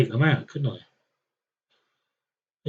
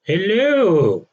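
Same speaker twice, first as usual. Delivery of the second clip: a higher, louder posh "Hello", hammed up; posh greeting; 7 semitones higher, 6 dB louder than usual. Player greeting the Athenians in a mock posh hello. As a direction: greet them like a toff, high and bright. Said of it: "Very posh".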